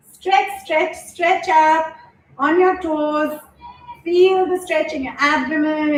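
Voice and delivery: encouraging yoga teacher guiding the stretch; yoga instructor cueing. Acted. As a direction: lead the stretch, call it out to the mat.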